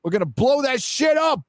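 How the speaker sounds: high, shouted mobster threat; mock hitman. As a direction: build to a hammed-up gangster yell. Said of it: "trying to put hits on people".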